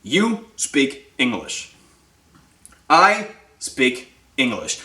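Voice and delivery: very slow, word by word; slow, deliberate and spaced out; emphatic declaration. Shown as a demonstration. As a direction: declare it with a pause after each word. Models a declaration to say aloud.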